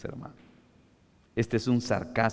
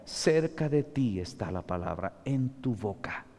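softer, gentle reading of the verse; softened scripture; 2 semitones lower, 7 dB quieter than usual. Acted. Preacher quietly reassuring believers with the verse, soft. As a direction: hold it close and tender.

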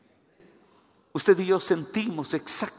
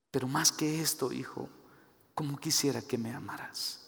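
soft, hushed plea for love; tender longing; 10 dB quieter than usual. Acted. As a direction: plead quietly, letting the voice sink. God's tender appeal to his child.

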